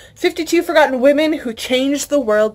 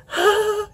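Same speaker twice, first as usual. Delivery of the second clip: sharp high gasp of delight; 12 semitones higher than usual. Real, not acted. Unboxing a longed-for book, a high delighted gasp. Seeing new book cover.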